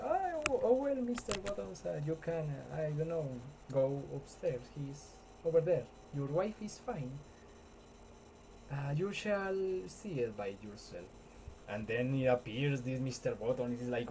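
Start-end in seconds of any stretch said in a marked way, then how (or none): 0.0-11.0 higher flustered nurse, lightly played; mock nurse voice. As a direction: play the nervous nurse up high. Nurse voiced in retold scene.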